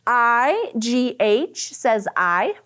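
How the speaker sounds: slow spelled-out phonics drill. Shown as a demonstration. Teacher drilling spelling patterns slowly with the class. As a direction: spell each letter slowly, then the sound. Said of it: "phonics drill".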